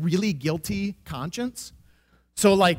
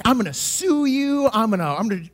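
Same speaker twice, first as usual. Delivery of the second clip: higher, slowed mock yell of a threat; angry threat; 4 semitones higher, 3 dB louder than usual. Grandfather threatening to sue over the car, mock yell. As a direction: retell the old man's rage, half-hammed. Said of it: "he's yelling so bad".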